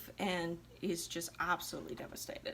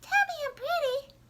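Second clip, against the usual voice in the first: very high, louder, squeaky mock voice; mock a vain girl fishing for compliments; shallow airhead; 6 dB louder than usual. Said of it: "How shallow is this chick".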